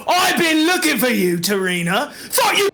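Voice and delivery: put-on accented boss cornering a thief; menacing pirate captain. Game master plays menacing Captain Deadeye.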